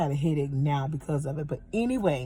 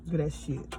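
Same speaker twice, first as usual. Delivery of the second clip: ordinary delivery, nothing marked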